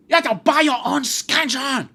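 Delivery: high, loud screamed order; screaming teacher. Retold or imitated. Teacher repeats her demand to the class, screaming. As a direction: scream it as a mocking teacher impression. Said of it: "Screaming that".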